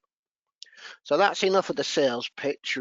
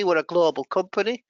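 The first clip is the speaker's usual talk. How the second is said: ordinary delivery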